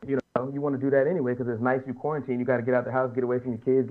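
usual speaking voice

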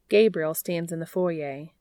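ordinary delivery, nothing marked

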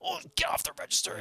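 very high, softened squeal; mock excited scramble. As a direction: imitate an urgent little cry. People scramble to see star.

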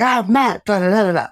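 high-pitched mock protest; protesting retort. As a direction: argue back high, tailing into mimicked blather. Acted retort in retold quarrel.

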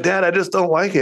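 put-on reluctant kid. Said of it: "he was like".

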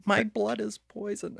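put-on high, slow, pitiful kid voice; mock sick kid. Quoting The Cure to make his friend laugh, high pitiful kid voice. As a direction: whimper it like a tragic movie child. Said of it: "Okay, I'll stop".

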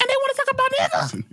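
very high, rushed incredulous squeal; mock outrage. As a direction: blurt it in high-pitched disbelief. Mock outrage over the word.

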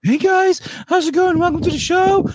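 very high squeaky greeting; high mock host voice.

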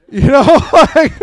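loud, high burst of laughter; real laugh breaking into the words. Real, not acted. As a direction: crack up mid-sentence. Cracks up after his own story.

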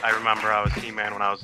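usual speaking voice

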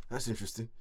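imitating a low, soft mutter; muttered under his breath. Quoting a player's reply on being point guard, muttered. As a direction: mumble it quietly, as if to yourself. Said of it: "He kinda muttered it under his breath".

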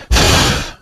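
low, audible sigh; resigned sigh. A narrator's reading. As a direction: let out a low sigh before giving in. Reluctant character before getting it over with.

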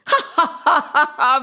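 gloating mock villain laugh. Vishwarath gloats over the drowning ashram, mock villain laugh. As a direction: ham up a boastful cackle. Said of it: "Vishwarath said".

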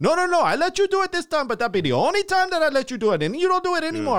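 loud, high imitated Indian accent. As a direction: scold like a clerk, in an Indian accent. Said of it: "that's how he sounded".

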